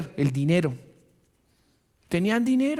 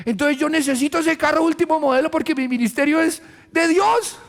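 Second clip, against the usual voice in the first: mock greedy believer; 9 semitones higher than usual.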